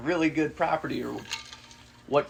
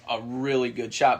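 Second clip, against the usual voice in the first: ordinary talk, nothing marked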